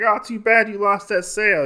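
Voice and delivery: light, mocking false sympathy; mock taunt. Customer taunting the swordsmith over his sale, mock taunt.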